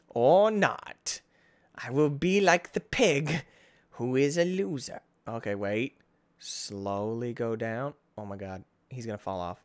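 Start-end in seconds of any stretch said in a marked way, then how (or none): 5.3-9.6 tense hush. Real, not acted. Tense hushed moment in game.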